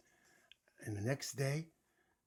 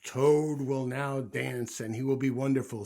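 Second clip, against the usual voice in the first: announcer voice; 9 dB louder than usual. A narrator's reading. Voice announces Toad's dance, booming. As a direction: boom out the next act. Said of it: "said the voice".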